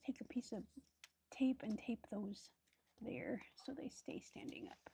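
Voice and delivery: soft unvoiced whisper while working; whispering to herself. Real, not acted. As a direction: whisper it under your breath. Thinking aloud while crafting.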